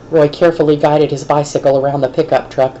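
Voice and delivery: ordinary delivery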